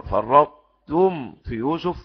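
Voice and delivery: slow chanted فرطتم في يوسف; slow melodic Quran chant; Quran recitation. Acted.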